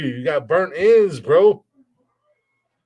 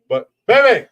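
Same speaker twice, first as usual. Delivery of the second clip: loud call across the house, pitched up; 8 semitones higher, 8 dB louder than usual. Real, not acted. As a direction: call her from another room. Calling to girlfriend in another room.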